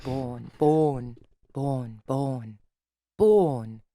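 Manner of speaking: "born" over and over, no r, long vowel; British vowel drill. Shown as a demonstration. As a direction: obsessively practise "born" with a round British vowel. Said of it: "walk around doing that".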